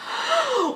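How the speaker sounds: shocked sharp gasp, high. Retold or imitated. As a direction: gasp as if the news just landed. Shock at the revelation.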